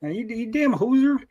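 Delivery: high-pitched Canadian caricature; mock Canadian accent. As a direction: scold in a hammed-up Canadian accent. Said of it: "That's Canadian sound".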